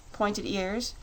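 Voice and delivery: plain reading voice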